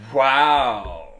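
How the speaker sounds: imitating her flat wow. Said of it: "For example, I would say".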